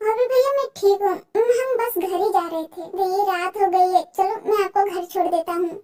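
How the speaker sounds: normal talking voice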